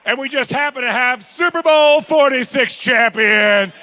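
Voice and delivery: loud, high announcer shout, slow on the name; host bringing Kevin Wade on for the crowd; hype introduction. Real, not acted. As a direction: introduce him like a champion. Introducing a Super Bowl champion.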